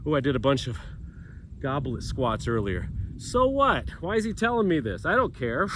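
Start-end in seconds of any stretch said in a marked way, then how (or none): 3.2-5.7 higher-pitched put-on voice of an uninterested viewer; voicing an imagined viewer who doesn't care; mock bored viewer. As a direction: sneer back at the instructor as a fed-up watcher. Imagined bored viewer talking back.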